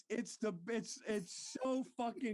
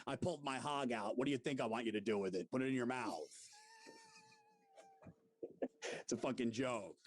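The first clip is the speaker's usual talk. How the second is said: much lower, gruff rant with laughs breaking in; Florentine impression; 9 semitones lower, faster than usual. Host does Florentine for his co-host, low grumbling rant. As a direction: grumble about the halftime show like a grouchy radio guy. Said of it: "I could do a good Florentine".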